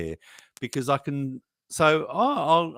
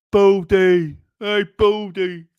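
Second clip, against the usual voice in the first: mocking taunt; 5 semitones higher, 9 dB louder, slower than usual.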